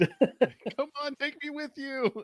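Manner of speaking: high, pleading voice; eager abductee. Pretend. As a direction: beg the aliens to take you.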